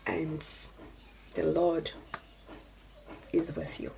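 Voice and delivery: soft breathy whisper of blessing; hushed, tender blessing. Real, not acted. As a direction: whisper it like a prayer over her. Tender blessing to her daughter.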